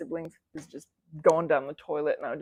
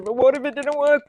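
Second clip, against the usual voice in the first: 1 anxious worry; 8 semitones higher, 9 dB louder than usual. Retold or imitated. Acting out emotional roller coaster.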